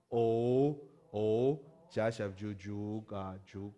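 drawn out slow recitation; slow spelling out. Shown as a demonstration. Teacher repeating the spelling, slow. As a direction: spell it again slowly. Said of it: "就是变成哦".

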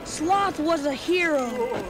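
ordinary delivery, nothing marked

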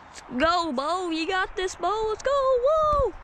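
rising, building cheer to the fish. Real, not acted. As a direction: urge the fish in, pitch climbing.

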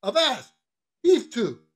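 high, loud halt order; seaman's command. Acted. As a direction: call out like a ship's officer. Mate halts the departing cook.

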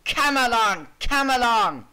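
loud, impatient order; rude call. Acted. Engine ordering his coaches along, rude shout. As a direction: call out rudely at full voice. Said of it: "he called rudely".

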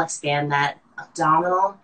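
ordinary delivery

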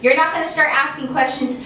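usual speaking voice

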